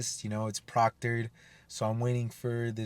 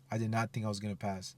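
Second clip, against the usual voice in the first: deflated murmur; 8 dB quieter than usual. Retold or imitated. Repeats the line, then deflated.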